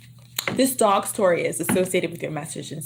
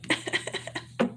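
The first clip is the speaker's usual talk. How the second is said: stifled laugh; 9 dB quieter than usual. Real, not acted. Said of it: "I wanna laugh so hard".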